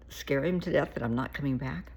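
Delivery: normal talking voice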